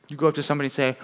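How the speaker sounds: normal talking voice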